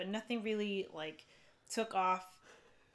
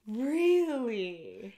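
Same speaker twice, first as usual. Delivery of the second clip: surprised; 4 semitones higher, 8 dB louder than usual. Real, not acted. Reacting to praise of the bar.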